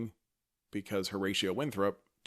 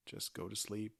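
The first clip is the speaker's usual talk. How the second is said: soothing bedtime voice; 8 dB quieter, slower than usual. Pretend. Lulling bedtime send-off.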